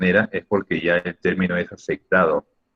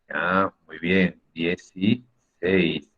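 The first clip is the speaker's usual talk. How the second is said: slow approval; 2 semitones lower, 4 dB quieter, much slower than usual. Shown as a demonstration.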